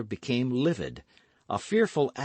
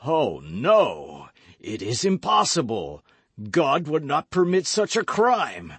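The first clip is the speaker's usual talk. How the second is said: horrified denial; 3 semitones higher, 5 dB louder than usual. Acted. Athos realizing the poisoning.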